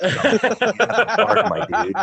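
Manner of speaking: high, loud, hammed-up declaration; slow, high mock-reverent shout; mock drunken proclamation. Acting out drunken bar exchange.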